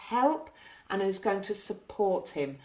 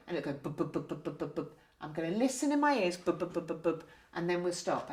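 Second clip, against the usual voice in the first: softly voiced bub bub heartbeat sounds; soft imitated heartbeat; 3 semitones lower, 5 dB quieter than usual. Shown as a demonstration. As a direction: mimic the stethoscope heartbeat quietly. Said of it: "dropped my language to something that you wouldn't generally use".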